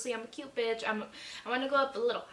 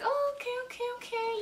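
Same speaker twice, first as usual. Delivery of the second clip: playful mock reaction; 12 semitones higher than usual. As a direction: act out a surprised reply, way up high. Acting out her flattered texted reaction.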